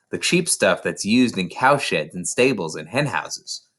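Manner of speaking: plain reading voice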